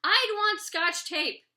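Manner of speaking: put-on slow, loud, high kid yell. A narrator's reading. Oliver insisting to the teacher, a slow loud yell. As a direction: belt it out to the whole class. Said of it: "Oliver yells".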